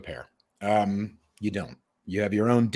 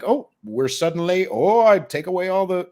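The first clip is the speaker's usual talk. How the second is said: a bad, lightly put-on English accent, raised and louder; half-hearted British accent; mock English accent; 8 semitones higher, 5 dB louder than usual. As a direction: imitate the English, badly. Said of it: "I can't do an English accent".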